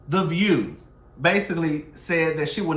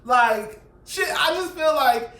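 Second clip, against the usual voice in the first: much higher, louder, disbelieving; incredulous outburst; 7 semitones higher, 5 dB louder, slower than usual. Real, not acted. Vlogger shouting disbelief at viewers, much higher and louder.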